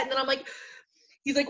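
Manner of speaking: normal talking voice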